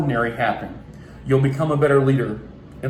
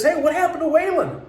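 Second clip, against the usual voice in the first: put-on puzzled kid; 11 semitones higher than usual. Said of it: "And he says".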